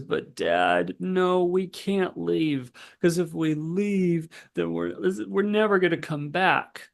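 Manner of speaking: put-on high, slow, whiny little girl voice; mock-tearful child begging to stay; upset four-year-old. Child's meltdown quote, voiced by teller.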